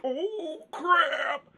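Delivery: high, startled cry of panic; mock panicked cry. Man surprised on the toilet by a monster, high panicked yelp. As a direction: yelp it like someone caught off guard on the toilet.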